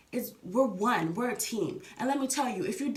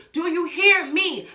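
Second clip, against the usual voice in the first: higher, louder, slow and pleading; insistent loud repeat; 5 semitones higher, 8 dB louder, slower than usual. Real, not acted. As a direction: demand they really listen. Asks listeners, then repeats insistently.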